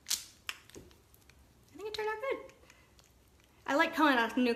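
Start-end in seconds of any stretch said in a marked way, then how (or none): 0.1-2.4 high soft pleased murmur. Real, not acted. Soft pleased self-reply.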